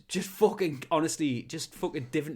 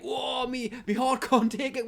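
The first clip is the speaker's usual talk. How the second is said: put-on much higher, breathless old raver; mock old man; 8 semitones higher, 3 dB louder than usual. Pensioner at a rave complaining about his heart, mock. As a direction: gasp like a frail pensioner. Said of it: "and he'd be like".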